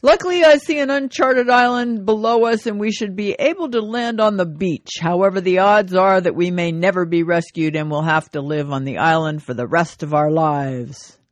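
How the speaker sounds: hammed-up captain's PA announcement. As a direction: announce it like a pilot on the intercom. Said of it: "over the public address system, the captain announces".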